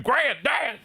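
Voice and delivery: high shout of Granddad!; high granddad call. Acted. Streamer hypes the Nintendo game, high shouted call.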